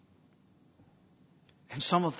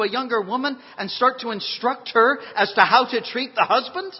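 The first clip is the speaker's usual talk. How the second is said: high-pitched rhetorical challenge, growing louder; preaching; 8 semitones higher, 9 dB louder than usual. Real, not acted. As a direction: challenge the congregation with insistent questions. Preaches at full force.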